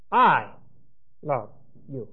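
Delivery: "I" stressed, then a pause before each word. Shown as a demonstration. Teacher showing that the pronoun emphasizes the subject, stress on "I". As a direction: punch "I", let "love" and "you" fall away. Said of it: "you are emphasizing not so much the verb".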